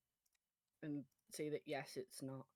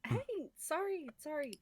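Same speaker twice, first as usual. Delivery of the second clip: higher, hammed-up sorry; over-the-top apology; 9 semitones higher, 3 dB louder than usual. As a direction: gush apologies, slowly. Character apologizes after spilling drink.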